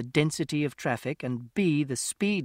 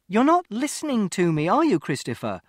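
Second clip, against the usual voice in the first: raised, higher exasperated voice; mother losing patience with her son, raised voice; frustrated mother; 7 semitones higher, 6 dB louder than usual. Acted. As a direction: snap at him, losing patience.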